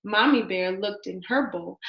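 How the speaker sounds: ordinary delivery, nothing marked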